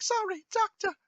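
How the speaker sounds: high-pitched mock frightened apology; scared woman. Retelling the abusive doctor scene to friends, mock scared. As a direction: imitate a cowering woman, voice jumping up high. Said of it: "she's so scared".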